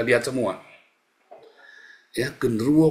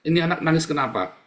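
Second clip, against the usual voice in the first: ordinary delivery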